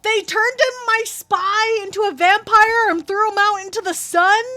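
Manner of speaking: loud, high outraged disbelief; outraged yelling. Real, not acted. Streamer yells at chat over the dead spy, outraged. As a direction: yell at the players in high-pitched outrage.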